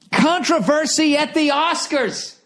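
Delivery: slow, high announcer voice; hammed-up news teaser; mock announcer. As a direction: read it like a tabloid headline.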